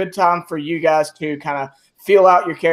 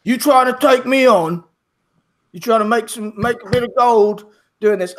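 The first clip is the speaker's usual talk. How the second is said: a hint of a swaggering half-orc fighter; tough half-orc; 5 semitones higher than usual. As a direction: sound like a fighter spoiling for a brawl. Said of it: "looks at you and says".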